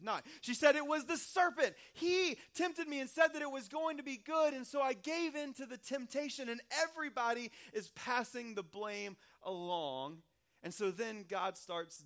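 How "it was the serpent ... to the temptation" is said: high, loud, pointing the finger; defensive blaming. Retold or imitated. Pastor plays Eve blaming the serpent before God, high and loud. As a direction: push the blame away, voice up and loud.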